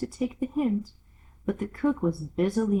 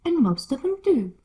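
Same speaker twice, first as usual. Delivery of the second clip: lightly voiced Duchess; 7 semitones higher, 5 dB louder than usual. Duchess answering Alice, lightly haughty.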